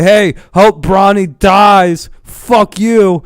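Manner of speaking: loud, high, drawn-out heckle; mock fan taunt. Fans heckling a player about his child, loud mock taunt. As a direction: stretch it out like a jeering fan. Acts out fans' taunting chant.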